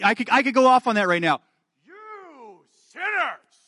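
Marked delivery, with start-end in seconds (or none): 1.8-3.6 high, slow mock condemning preacher voice; fire and brimstone preacher. Preacher mocking a condemning movie preacher, hammed-up scolding. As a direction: ham up a shrill hellfire preacher. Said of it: "acting that way".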